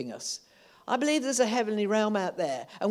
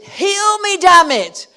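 heal me, damn it, shouted much higher and louder; bold demanding shout; 12 semitones higher, 16 dB louder, slower than usual. Pretend. Preacher showing brazen prayer, loud and high shout. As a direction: demand it of God with brazen nerve. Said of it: "Or is it".

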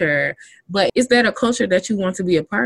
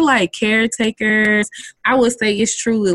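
ordinary talk

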